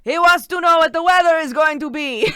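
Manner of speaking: put-on an old Italian nonna repeating the question; Italian grandma talking to the phone; Italian grandma accent. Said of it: "mimicking what her accent was like".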